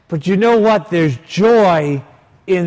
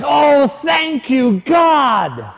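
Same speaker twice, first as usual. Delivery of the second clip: overjoyed shout; 9 semitones higher, much slower than usual. Retold or imitated. Priest thrilled to learn she was raised Orthodox, cries out in joy. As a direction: throw it up to heaven with relief.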